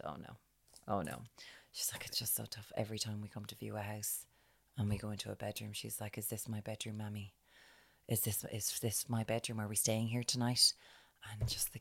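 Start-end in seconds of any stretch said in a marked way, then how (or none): 0.0-1.2 much softer, lower sympathetic "oh no"; hushed sympathy. Retold or imitated. Reacting to the mother's story, soft and sympathetic. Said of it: "And I went". 1.7-10.7 much softer, hushed telling; hushed quote. Retold or imitated. A mother sharing her struggle, quiet and hushed. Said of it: "she was just like".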